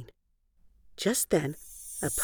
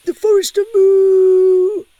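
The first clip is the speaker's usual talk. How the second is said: put-on high loud old conductor's cry; train conductor calling; 10 dB louder than usual. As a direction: bellow the last stop like a conductor. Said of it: "the conductor shouted".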